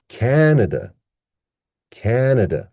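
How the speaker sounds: "Canada" with high, long CAN and two weak schwas; stressed first syllable. Shown as a demonstration. Teacher modelling the correct pronunciation, high CAN in "Canada". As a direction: punch CAN in "Canada", let the rest fall away. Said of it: "That's the correct pronunciation".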